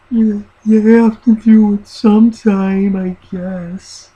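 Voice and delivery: high, very slow put-on voice; mock mentally challenged voice. Sketch personality who doesn't understand, slow high mock-impaired voice. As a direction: drag the words out slowly in a high caricature. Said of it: "but he's mentally challenged".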